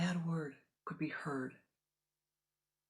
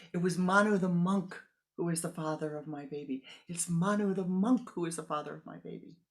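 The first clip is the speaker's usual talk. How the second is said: louder, forced-out admission; blurted confession; 2 semitones higher, 6 dB louder than usual. Acted. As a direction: finally blurt the secret out.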